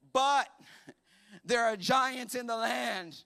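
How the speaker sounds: slow, loud, weighty reveal; dramatic reveal. Acted. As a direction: drop the bad news slowly. Dramatic reveal of bad news.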